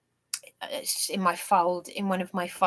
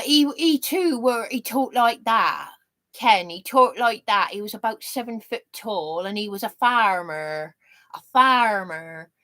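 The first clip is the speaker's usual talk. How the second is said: hammed-up country Sussex burr; exaggerated Sussex accent; 2 semitones higher, 3 dB louder than usual. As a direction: imitate a rustic Sussex farmer, laying it on. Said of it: "I'm slightly exaggerating".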